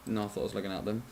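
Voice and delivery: usual speaking voice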